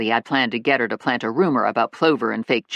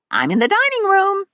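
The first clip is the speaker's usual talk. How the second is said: high, loud call from another room; old lady yelling; 4 dB louder than usual. A narrator's reading. Grandma calling back to Stephanie from the dining room, high-pitched yell. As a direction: shout it through the house in a high old-lady voice. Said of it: "Grandma yelled back".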